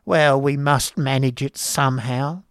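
lightly voiced grudging wife, slow; a hint of a cold mistress; Mrs Squeers' voice. A narrator's reading. Wife answering her husband, slow and grudging. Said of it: "replied the lady".

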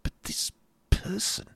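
soft, breath-light, high protest; hushed urging. A narrator's reading. Sister urging her brother privately, hushed. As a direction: press him in a hushed voice. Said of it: "urged Kate".

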